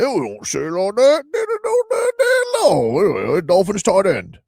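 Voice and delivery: loud, very high British caricature; mock British accent. Host imitating a Dolphins tight end, mock British accent. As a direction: squawk it in a hammed-up British accent. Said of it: "He doesn't even sound like that".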